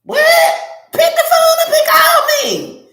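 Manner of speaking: very high-pitched, incredulous cry; squeaky, exasperated demand; exasperated squeal. Acted. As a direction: shoot way up high in mock disbelief. Acts out exasperation at texting.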